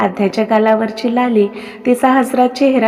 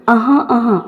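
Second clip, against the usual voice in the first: mock throat clearing. A narrator's reading.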